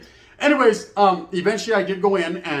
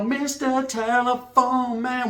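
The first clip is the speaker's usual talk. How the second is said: high, slow crooning phone voice; mock smooth-talker croon; 6 semitones higher, much slower than usual. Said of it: "You know".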